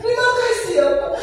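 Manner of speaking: high, choked-up grateful thanks; tearful thanks. Retold or imitated. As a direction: thank him as if close to tears. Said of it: "Nangis banget".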